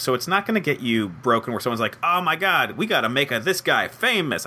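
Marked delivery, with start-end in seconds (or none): 2.0-4.5 high, excited mock Italian accent. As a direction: hype it up like a comic Italian bigwig. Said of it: "gave him an Italian accent".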